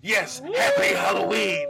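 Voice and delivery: high, slow mock-monster shout; scary greeting. Hidden host scaring a three-year-old after wife's greeting, spooky shout. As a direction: boom it out, stretched and spooky.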